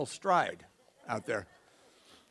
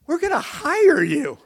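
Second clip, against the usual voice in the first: very high, loud, slow squeal; hammed-up rattled announcement; imitating shaken woman; 12 semitones higher, 9 dB louder, slower than usual. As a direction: force it out high, as if shaking. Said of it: "she comes in shaking".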